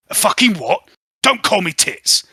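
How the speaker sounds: irritated Titulos. Acted. Titulos snapping at Spring, loud. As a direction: bark it back at her.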